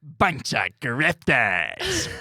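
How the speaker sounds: slow, loud, high cackling villain; Green Goblin voice. Acted. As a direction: drawl it out like a gleeful supervillain. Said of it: "that's my Green Goblin voice".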